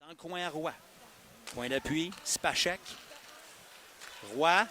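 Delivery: normal talking voice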